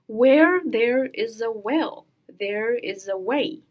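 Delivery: slow, deliberate model reading; slow sentence demo. Shown as a demonstration. Teacher models a sentence for learners, slow and deliberate. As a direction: read it slowly, drawing out the first word. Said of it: "我们来看一下".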